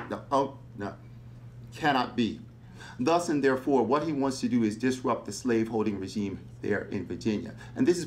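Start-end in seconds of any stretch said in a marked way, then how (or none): none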